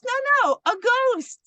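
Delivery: put-on an eager small girl insisting; little girl. Little girl voiced in story.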